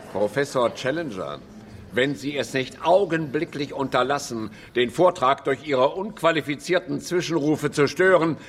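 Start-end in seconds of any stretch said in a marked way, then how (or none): none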